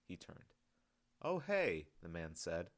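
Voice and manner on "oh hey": light, pleased, smiling a little; hint of a smile. A narrator's reading. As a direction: say it with a small smile. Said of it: "with a hint of a smile in his voice".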